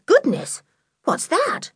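high-pitched alarmed exclamation; a child's sudden start at a noise; startled. A narrator's reading.

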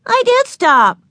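put-on high-pitched little-kid protest; indignant retort. A narrator's reading. Little sister insists she kept her promise, indignant.

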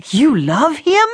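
rising, appalled echo; the father repeating in disgust; revolted disbelief. A narrator's reading. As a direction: recoil at her words.

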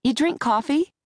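high, lively hostess offer; bright offer. A narrator's reading. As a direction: pitch it up as an eager host. Jackie offering drinks brightly.

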